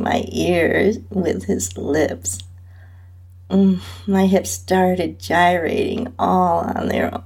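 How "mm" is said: ordinary delivery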